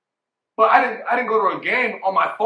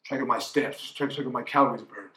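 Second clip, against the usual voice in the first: ordinary talk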